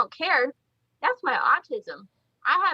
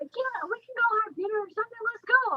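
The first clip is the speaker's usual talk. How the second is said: much higher, eager greeting and invite; friendly Chase; 8 semitones higher, 3 dB quieter than usual. Retold or imitated. Chase inviting Anna over to his booth, high eager voice. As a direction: bubbly welcome calling her over. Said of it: "Chase is like".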